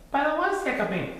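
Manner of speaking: high-pitched little cub; high storybook cub; Little Bear character voice. A narrator's reading. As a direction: answer high and small like a young bear.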